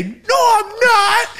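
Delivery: high, slow shouted denial. Retold or imitated. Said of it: "As I say".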